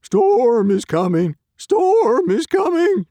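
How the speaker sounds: loud, high, drawn-out dog bark. A narrator's reading. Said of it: "barked Dog".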